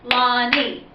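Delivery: Lonnie split into Lon-nie, one clap each; two claps for Lonnie; clapping syllables. Shown as a demonstration. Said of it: "my name is Lonnie".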